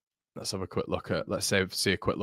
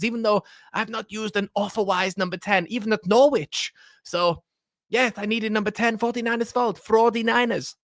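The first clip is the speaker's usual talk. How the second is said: Farke impression; 13 semitones higher than usual. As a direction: put on a squeaky caricature of a football manager making excuses. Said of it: "Farke impression".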